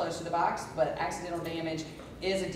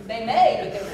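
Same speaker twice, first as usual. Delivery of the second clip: laughing; 6 semitones higher, 12 dB louder than usual. Real, not acted. Laughing over the accent question.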